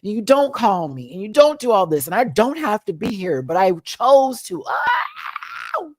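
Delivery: high, loud tearful mom outburst; cry-shout it like the scolding mother. Retold or imitated. Retelling the movie mother's speech to her daughter, crying loudly. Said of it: "the mom and daughter scene".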